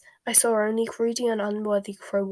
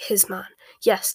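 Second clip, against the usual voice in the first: plain reading voice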